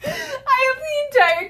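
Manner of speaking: high squealing laugh-cry; laughing so hard it hurts; crying with laughter. Real, not acted. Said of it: "I'm crying".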